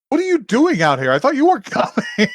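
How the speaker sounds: high-pitched retold astonishment, laughing at the end; mock bafflement that climbs high; incredulous surprise. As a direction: imitate a flustered, disbelieving kid. Voicing boy's surprise at meeting her.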